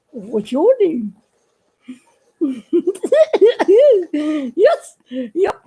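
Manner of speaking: slow, lightly voiced puppet question; a hint of a giggly puppet. Puppet Billy Bob asks the viewer's name, slow and giggly.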